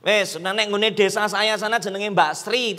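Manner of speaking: ordinary delivery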